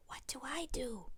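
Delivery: hushed unvoiced question; whisper. A narrator's reading. Little brother whispering before the show starts. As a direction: whisper it backstage. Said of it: "whispers Eduardo".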